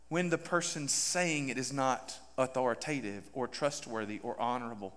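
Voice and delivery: quiet. Acted. Drops voice after shout.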